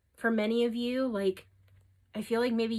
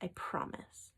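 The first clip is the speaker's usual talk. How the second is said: a much softer, whispered "I promise"; 2 semitones lower, 10 dB quieter than usual. Real, not acted. Reader promises viewers it will be okay, whispered.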